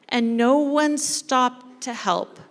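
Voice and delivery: ordinary delivery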